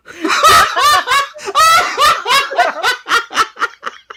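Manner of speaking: high shrieking laughter; loud laughing howl. Real, not acted. Reacting to funny image, loud shrieking laughter. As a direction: burst out howling with laughter.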